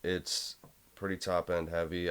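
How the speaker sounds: normal talking voice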